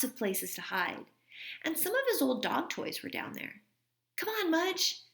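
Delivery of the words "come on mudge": high calling out; boy calling his dog downstairs. A narrator's reading. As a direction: call the dog across the basement. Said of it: "Henry called".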